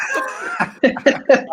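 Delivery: a real high-pitched laugh. Real, not acted. Laughs after outburst.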